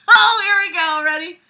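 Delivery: loud, high rallying cry; hyped-up bracing. Real, not acted. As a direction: psych yourself up noisily. Bracing loudly to read on.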